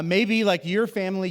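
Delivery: ordinary delivery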